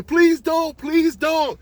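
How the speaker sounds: high, loud desperate scream; screaming plea. Acted. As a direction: beg at full cry like a terrified child. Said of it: "and she's screaming".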